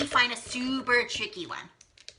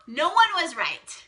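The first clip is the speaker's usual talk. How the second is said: gasp, then higher and louder; surprised reveal; 9 semitones higher, 5 dB louder than usual. Real, not acted. Teacher revealing the star to the class, surprised gasp. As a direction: gasp in playful shock.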